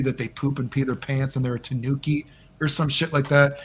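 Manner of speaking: usual speaking voice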